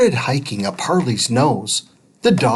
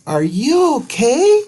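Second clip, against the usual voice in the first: 1 shouting down; 10 semitones higher than usual. A narrator's reading. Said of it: "Judy shouted down".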